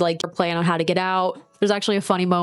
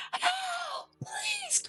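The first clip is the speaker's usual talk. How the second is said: very high, much softer pleading; feeble plea for help; 13 dB quieter than usual. Retold or imitated. Bloodied bride begging on the road, high frail plea. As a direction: whimper it like a helpless bride. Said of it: "she's like".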